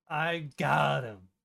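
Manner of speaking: slow, self-satisfied gloat; smug. Retold or imitated. Imitating the summoner, a slow smug gloat. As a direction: savor it like an unearned win. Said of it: "unearned smug attitude".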